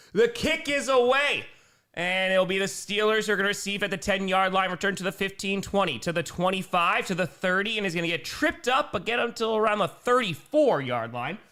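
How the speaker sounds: excited kickoff call; sportscaster tracking the runner; live play-by-play. Real, not acted. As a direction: call the return as it happens. Calls the game live.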